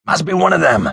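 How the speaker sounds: high, tense assertion; alarmed insistence. A narrator's reading. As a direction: insist in a rising panic. Joel panics over the living body.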